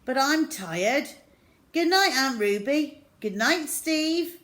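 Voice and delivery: put-on high sleepy-cheerful boy; a boy saying goodnight, voice up high; child voice. Henry says goodnight.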